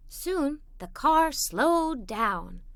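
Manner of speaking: plain reading voice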